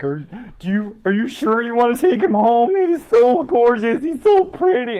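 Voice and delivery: high cooing over a pretty dog; gushing vet techs. Retold or imitated. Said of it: "all the vet techs and shit were like".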